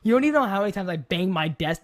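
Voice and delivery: ordinary talk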